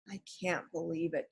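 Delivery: soft, near-tearful; close to tears. A narrator's reading. Jack sad the books are lost, near tears. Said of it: "He was close to tears".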